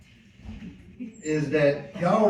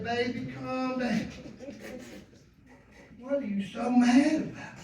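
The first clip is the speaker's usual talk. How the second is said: soothing grandma; 4 semitones higher, 7 dB quieter than usual. Retold or imitated. Grandma soothing him in story.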